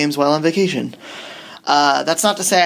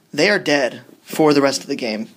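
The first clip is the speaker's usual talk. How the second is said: usual speaking voice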